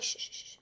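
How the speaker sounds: soft repeated shush; quick unvoiced shushes; mock shushing. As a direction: hush a nagging inner voice. Shushes own inner voice.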